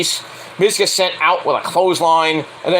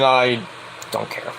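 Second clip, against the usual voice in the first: low, soft throwaway; quiet indifference; 6 semitones lower, 5 dB quieter, slower than usual. Real, not acted. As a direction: drop it under your breath, uninterested. First take, dismissive aside.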